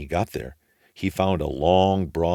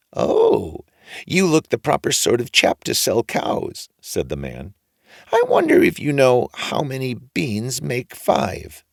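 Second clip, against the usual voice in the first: very high squeaky greeting; funny old man; 7 semitones higher, 4 dB louder than usual. A narrator's reading. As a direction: greet him like an odd little old man.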